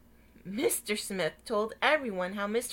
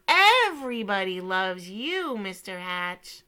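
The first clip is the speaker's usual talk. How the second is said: louder, proud reading of the sign; proud announcement; 10 dB louder than usual. Acted. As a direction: proclaim it warmly.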